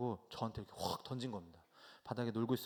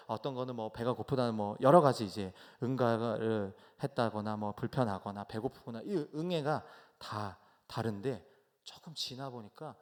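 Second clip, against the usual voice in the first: normal talking voice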